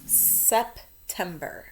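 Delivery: slow, careful dictation of September; spelling-test dictation, drawn out. Shown as a demonstration. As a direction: say each syllable clearly and slowly. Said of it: "we're going to spell".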